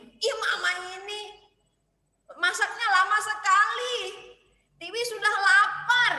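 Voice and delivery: high, loud annoyed whine; child complaining. Acted. Hungry child complaining to mom, high and loud. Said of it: "dia bilang gitu kan".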